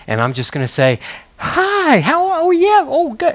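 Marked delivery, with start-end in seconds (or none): 1.4-3.3 high, gushing mock greeting; a much higher, fawning mock voice; mock enamored. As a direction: coo it like a starstruck fan. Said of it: "get all kind of enamored".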